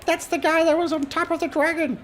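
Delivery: put-on very high excited little girl voice. Little girl in the tavern, high excited child voice. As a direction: squeal it like a small child pointing. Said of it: "A little girl pipes up".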